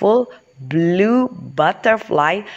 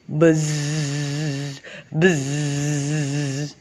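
long droning bee buzz; steady buzzing hum on the z; 2 semitones lower, 4 dB quieter than usual. Acted. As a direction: imitate a bumblebee with a drawn-out buzz. Imitating a bumblebee flying by.

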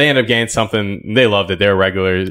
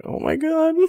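shocked gasp; 5 dB quieter, slower than usual. Retold or imitated. Fired employee's shocked reaction.

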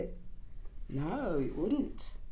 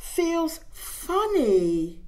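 put-on baby voice; 6 semitones higher, 4 dB louder, much slower than usual. Baby character in story.

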